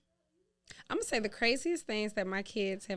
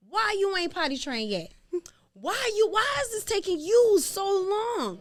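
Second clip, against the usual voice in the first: higher, louder exasperated pleading; exasperated parent; 7 semitones higher, 8 dB louder than usual. Retold or imitated. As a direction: whine it out like a fed-up parent. Exasperated parent retelling potty training.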